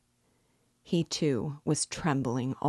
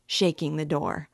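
usual speaking voice

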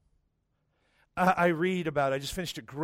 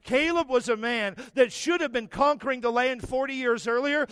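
rising, louder preaching build; 5 semitones higher, 4 dB louder than usual. Acted. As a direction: drive the sermon to its peak. Builds sermon climax.

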